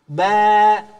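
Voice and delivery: Ba said loud with a light, thin alif. Shown as a demonstration. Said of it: "Maka alifnya jadi tipis".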